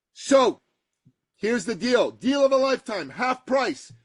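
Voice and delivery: high, driving deal announcement; retold fired-up offer; energetic sales pitch. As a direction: sell it with fire. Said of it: "with passion, with energy".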